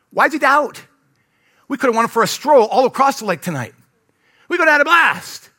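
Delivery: high, loud acted disbelief; hammed-up, high-pitched enthusiasm; playful exasperation. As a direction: exclaim it like an excited buddy who missed out. Acts out a casual, exuberant Jesus.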